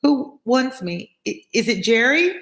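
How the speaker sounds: high, hesitant, slow questions; mildly quavering. A narrator's reading. Passenger Lorry to the unknown caller, mildly quavering. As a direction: quaver slightly and falter. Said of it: "then with mildly quavering speech".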